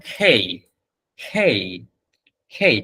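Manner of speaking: খেই said with a breathy aspirated kh; খেই spoken carefully, the kh sound pushed out with air; correct pronunciation. Shown as a demonstration. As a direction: repeat খেই with a clear aspirated kh, not কে. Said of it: "এটার উচ্চারণ হবে যেই এবং খেই".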